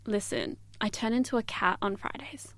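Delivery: retold low-key, hushed deadpan; imitating a quiet, casual brush-off. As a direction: say it softly and offhand, like a casual confession. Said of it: "I was like, casually".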